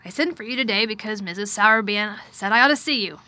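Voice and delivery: loud, emphatic, lightly voiced man; distracted but well-meaning guardian, forceful; troubled guardian. A narrator's reading. Guardian telling his ward his intentions, loud and troubled. Narrator voices Mister Craven.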